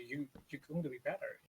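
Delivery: usual speaking voice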